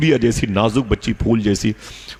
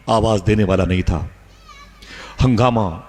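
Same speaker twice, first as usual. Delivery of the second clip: normal talking voice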